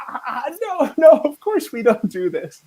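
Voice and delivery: high, loud amused "no, no"; laughing denial. Retold or imitated. Fixer revealing the joke, laughing. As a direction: laugh it off, teasing. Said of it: "starts laughing hysterically".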